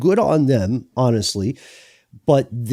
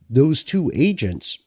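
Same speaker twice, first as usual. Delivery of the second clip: usual speaking voice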